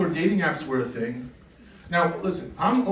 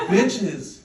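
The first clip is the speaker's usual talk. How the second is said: higher and louder, laughing scorn at spoiled Germans; indignant retort; 9 semitones higher, 4 dB louder than usual. Retold or imitated. Comic answering complaining Germans about trains, loud scornful mockery. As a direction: shout it up high, incredulous and mocking. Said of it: "I'm like".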